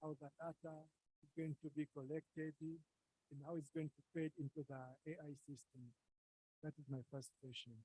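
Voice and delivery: low, faint voice; speaking too quietly for the mic; barely audible. Real, not acted. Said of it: "your voice is very low".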